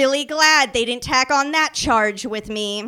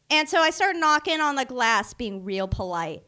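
ordinary talk

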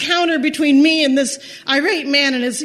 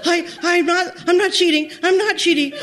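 higher, tearful stammering denial; a retold teary stammer, voice pitched up; crying stammer; 5 semitones higher than usual. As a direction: stammer it out through tears, pleading. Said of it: "start to cry and I stammer".

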